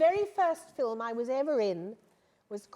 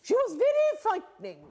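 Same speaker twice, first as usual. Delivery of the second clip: put-on higher, louder imperious old lady, lightly done; Fabia Drake voice; 9 semitones higher, 5 dB louder than usual. Recalling her first film's scary costar, mock grand-dame voice. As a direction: boom it out like a grand frightening dame. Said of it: "And she spoke like that".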